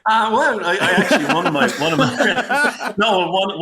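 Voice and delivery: high, loud laughing start; words bubbling out through laughter. Real, not acted. Guest laughs while starting his story, high and loud.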